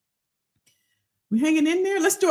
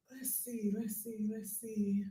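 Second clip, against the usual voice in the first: muttering to herself; 4 semitones lower, 17 dB quieter than usual. Real, not acted. Scrolling names to herself.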